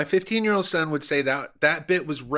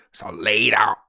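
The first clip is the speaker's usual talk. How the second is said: said out loud in a mock Cuban accent, a touch louder; voiced, in a mock Cuban accent; 4 dB louder than usual. Comedian repeating the tip to cohost aloud, in a mock Cuban accent. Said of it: "I was using an accent".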